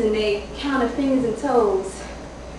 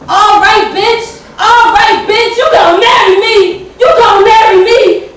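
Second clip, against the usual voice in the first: much higher and louder triumphant hollering; hooting and hollering; 10 semitones higher, 15 dB louder than usual. Acted. Father grabbing the baby, hollering in triumph at the mother. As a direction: crow over her, gloating at the top of your voice. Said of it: "laughing and hooting and giggling and hollering".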